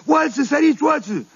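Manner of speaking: hammed-up cry of "Săriți"; imitated cry for help. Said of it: "începu să strige". (strigăt de ajutor imitat, mult mai sus)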